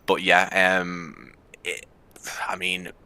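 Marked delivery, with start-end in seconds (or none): none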